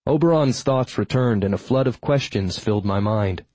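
plain reading voice

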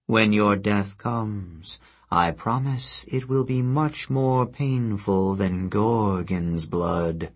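half-asleep goddess, grudgingly impressed; sleepy earth goddess. Acted. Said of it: "a sleepy whisper, grudging admiration".

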